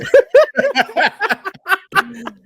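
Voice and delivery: high, loud cackling laugh; long loud laugh. Real, not acted. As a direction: crack up at the story. Laughing at Tracy Morgan story.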